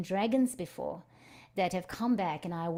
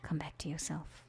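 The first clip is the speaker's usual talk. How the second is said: soft unvoiced whisper, gently closing; 11 dB quieter than usual. Facilitator gently bringing the group back, whispering. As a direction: breathe the words out without voice.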